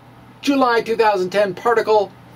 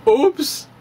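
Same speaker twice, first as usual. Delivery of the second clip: mock oops; 7 semitones higher than usual. Punchline after data shock.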